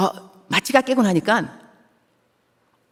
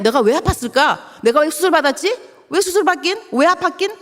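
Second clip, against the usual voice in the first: rhetorical questions; 9 semitones higher than usual. Acted. Rhetorical questions, self-answered.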